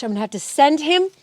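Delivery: usual speaking voice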